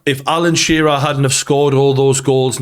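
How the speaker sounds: ordinary talk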